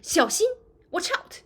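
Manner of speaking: high, loud 小心; urgent warning. Shown as a demonstration. Teacher demos a sharp warning before softening it, urgent. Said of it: "if you want to soften the tone".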